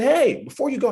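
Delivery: high, loud call to stop someone leaving; calling after them. Retold or imitated. Salesman chasing the couple to the door, calling out. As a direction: catch them before they're out the door. Running after departing customers.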